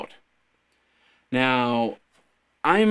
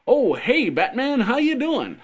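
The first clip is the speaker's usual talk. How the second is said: high, loud, chirpy mock greeting; hammed-up cheerful neighbour voice; cheery window dweller; 10 semitones higher, 3 dB louder than usual. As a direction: pop out a window, surprised and friendly. Imagined window dweller greets Batman.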